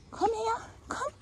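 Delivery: very high coaxing call; animal call. Real, not acted. Calling the escaped animal. (mit hoher Stimme lockend)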